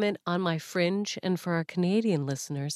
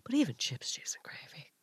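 whispered aside; 8 dB quieter than usual. Real, not acted. Fan letting the host in on a poutine secret, whispering.

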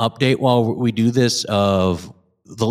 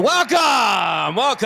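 hosting shout; 10 semitones higher, much slower than usual. Acted. Host opening the show.

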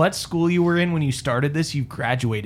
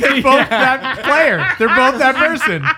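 high, loud outburst through laughter; comic exasperated yell; mock accusation; 9 semitones higher, 5 dB louder, slower than usual. Real, not acted. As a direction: shout the verdict, stretched out and amused.

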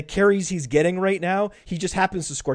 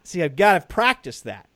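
ordinary talk, nothing marked

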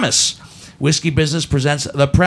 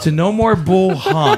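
laughing; 8 semitones higher, 3 dB louder, slower than usual. Pretend. Mock toast to one-star reviewer.

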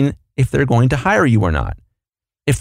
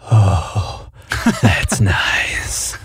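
low, slow, breathy creep, half whispered; creepy voice; 4 semitones lower, much slower than usual. Acted. Artist hearing the master, hammed-up creepy voice. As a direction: creep it out big, lips right on the microphone. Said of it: "do it in a big, creepy voice like that".

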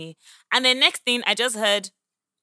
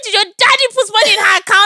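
very high, loud retold comeback; mocking retort; 13 semitones higher, 12 dB louder than usual. Woman defending her friend against a man's insult, loud taunt. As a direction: fire back loudly in a high, taunting re-enactment.

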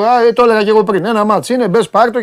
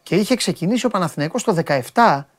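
usual speaking voice

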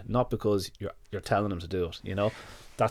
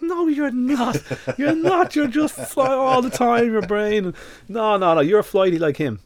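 much higher, laughing mock voice of the trainer; imitating the trainer; 13 semitones higher, 5 dB louder, slower than usual. Trainer laughing at the owner's claim, high mock voice. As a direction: tease him as the trainer. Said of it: "And he goes".

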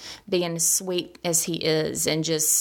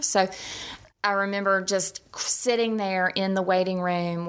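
normal talking voice

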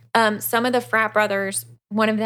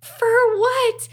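high, loud, shocked squeal; panicked disbelief; 4 dB louder, much slower than usual. Retold or imitated. Luke shocked when the cop arrests him, high panicked yelp. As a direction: yelp it like the floor just dropped.

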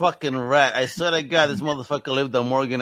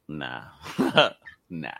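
disgusted refusal; 12 semitones lower than usual. Retold or imitated. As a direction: turn it down in disgust. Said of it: "I'm like".

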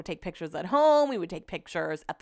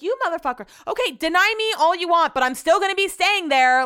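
high, louder mock tirade at the hosts; annoyed retort; 10 semitones higher, 5 dB louder than usual. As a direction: fire back, imitating the angry reply, pitched high. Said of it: "starting to get annoyed at this point".

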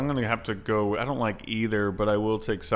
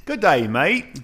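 high, loud Australian accent; 6 semitones higher, 5 dB louder, slower than usual. Shown as a demonstration. Guest doing the accent on the host's request, Australian. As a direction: put on a lifted Aussie accent. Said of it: "in an Australian accent".

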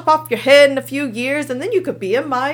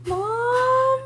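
mock scared child; 12 semitones higher, 3 dB quieter than usual. Scared child waking at night.

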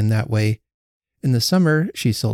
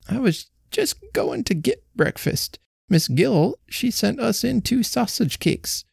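put-on fretful old woman; 8 semitones higher than usual. A narrator's reading. Old Betsy frets to Jenny about her sulking husband, high and anxious. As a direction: let an anxious old wife's voice quaver.

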